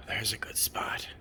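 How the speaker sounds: breathy whisper to a pet. Real, not acted. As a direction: hush it to the cat.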